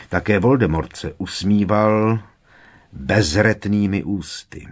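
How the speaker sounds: ordinary delivery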